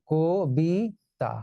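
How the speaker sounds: "কবিতা" very slowly as ko, bi, ta; syllable by syllable. Shown as a demonstration. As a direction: break "কবিতা" into three, opening on closed o. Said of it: "কো হয়ে যাবে". ("কবিতা" ভেঙে, কো-বি-তা)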